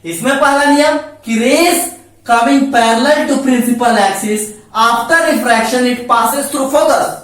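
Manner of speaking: each English word spaced out slowly for notes; slow dictation. Shown as a demonstration. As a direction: read the rule so students can write it down. Teacher dictating the rule slowly. (धीरे-धीरे लिखवाते हुए)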